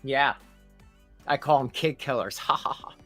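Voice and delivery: ordinary talk, nothing marked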